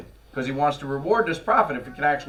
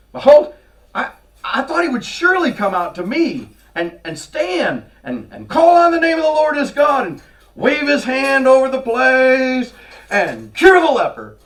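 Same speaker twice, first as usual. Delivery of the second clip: loud, high furious rant with laughter; hammed-up indignant shout; furious Naaman; 9 semitones higher, 9 dB louder than usual. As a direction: rant like an insulted captain. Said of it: "was furious".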